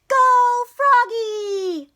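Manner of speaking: long, high, loud cheering holler. Acted. Mom cheering Froggy from the stands, hollering. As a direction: stretch out a big slow cheer. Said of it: "hollered Frogalina".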